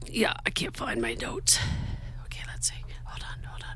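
hushed whisper while searching notes; muttering under the breath while hunting for notes. Real, not acted. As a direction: whisper to yourself, digging through notes.

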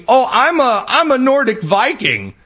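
high, whiny put-on excuse; hammed-up fake claim; mock recruit. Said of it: "so he tells the army".

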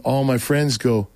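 usual speaking voice

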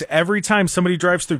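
normal talking voice

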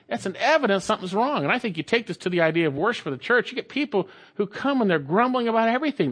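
ordinary talk

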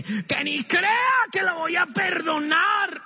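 high, slow shouted refusal to forgive; angry outburst. Acted. Believer ranting about someone who wronged him, high angry shout. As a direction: spit out the grudge at full volume. Churchgoer raging at offender.

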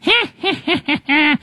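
high squeaky witch cackle. Pretend. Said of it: "That sounds like the Wicked Witch of the West".

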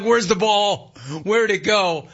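high-pitched imitation of baffled player; mock bewilderment. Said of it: "he's looking at his glove like".

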